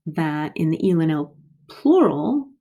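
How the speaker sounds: ordinary talk, nothing marked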